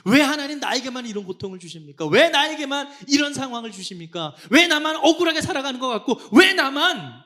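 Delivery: high, loud anguished complaint, building; resentful protest. Acted. Believer blaming God for their suffering, loud resentful outcry. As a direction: cry out at the unfairness. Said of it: "원망의 질문을 던지는".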